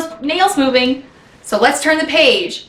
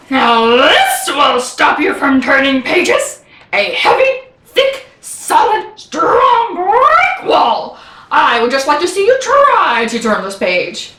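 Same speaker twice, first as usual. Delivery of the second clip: slow, emphatic cartoon boast; Grover, defiant; 3 semitones higher, 3 dB louder, slower than usual. Grover dares reader to pass brick wall, defiant boasting.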